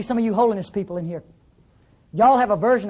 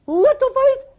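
"Little faith?" cried out very high; "Little faith?" flung up to a high exclaiming cry; near-shouted disbelief; 4 dB louder, slower than usual. Real, not acted. Louder incredulous repeat.

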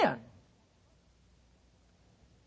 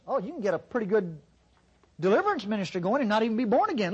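ordinary talk

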